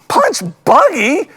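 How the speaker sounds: loud, high, disbelieving repeats; incredulous outrage. Real, not acted. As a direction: scoff at the name in outrage. Mocking the other name.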